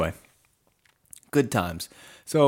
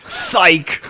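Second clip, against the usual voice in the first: teasing shout; 8 semitones higher, 10 dB louder than usual. Pretend.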